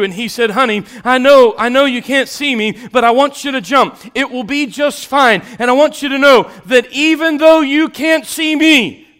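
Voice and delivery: high, loud preaching of the fire story; preaching at full force. Acted. Preacher telling the fire story loudly to the congregation. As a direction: cry out the story from the pulpit.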